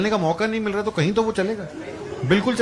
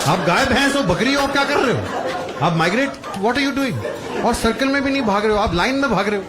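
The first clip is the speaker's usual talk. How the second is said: incredulous rant; 5 semitones higher than usual. Real, not acted. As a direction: rant at the runners in disbelief. Comic ranting about marathon runners.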